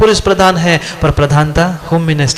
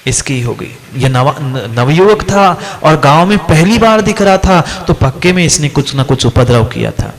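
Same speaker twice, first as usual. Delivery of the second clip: normal talking voice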